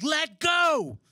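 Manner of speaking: a hint of a big rescuer insisting; firm command. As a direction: bark it like a lifeguard who won't argue. Rescuer orders him to let go.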